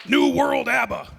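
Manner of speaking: mock announcer.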